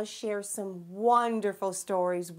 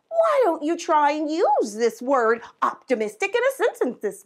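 higher, louder perky teacher character; an excited vocabulary host, pitched up and loud; Miss Vocab voice; 7 semitones higher, 9 dB louder than usual. Acted. Miss Vocab greeting the children, higher and louder.

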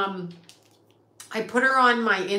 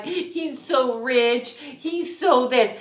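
slow, higher-pitched mock admiration; mock fawning; 4 semitones higher, much slower than usual. People who worship money admire him, mock fawning. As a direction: imitate gushing admirers, drawing it out.

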